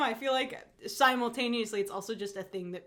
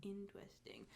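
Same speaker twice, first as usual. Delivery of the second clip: whispered; 2 semitones lower, 21 dB quieter than usual. Real, not acted. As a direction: breathe the word out, almost no voice. Echoing "interesting" in a whisper.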